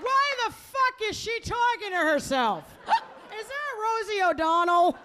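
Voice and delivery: high-pitched mock confusion with a gasp; baffled audience member. Audience member puzzled by the comic, high baffled mock voice. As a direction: heckle in an exaggerated, high bewildered voice. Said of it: "Some of you are like".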